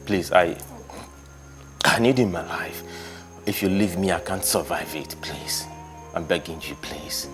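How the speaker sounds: kneeling husband begging his wife not to leave. Acted. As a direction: plead on your knees, broken.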